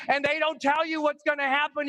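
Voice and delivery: normal talking voice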